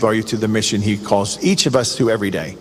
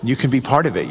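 ordinary talk, nothing marked

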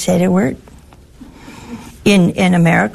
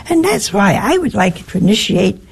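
usual speaking voice

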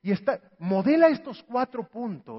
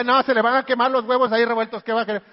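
fast fretting; 6 dB louder, faster than usual. Acted. Wife worried about eggs.

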